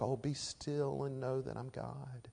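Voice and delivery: hushed, gentle, pillow-verse calm; quiet and soft. Shown as a demonstration. Preacher showing the gentle misreading, said softly. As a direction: breathe it softly like a cross-stitch. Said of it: "quietly and softly".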